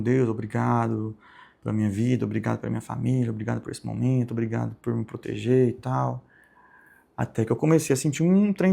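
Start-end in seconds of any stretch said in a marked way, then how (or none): 0.0-6.2 low, soft murmured thanks; quiet prayer. Retold or imitated. Man thanking God during prayer, quiet and low. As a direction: recite a private prayer, lowered and subdued.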